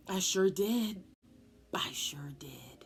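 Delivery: hushed, sly little whisper; sneaky whisper. Retold or imitated. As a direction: whisper it like a guilty kid. Child retelling a lie, whispering.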